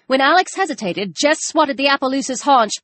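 plain reading voice

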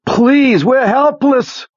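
high, drawn-out helpless cry; screamed plea. A narrator's reading. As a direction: scream for mercy, dragging out the last word. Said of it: "Pete screamed".

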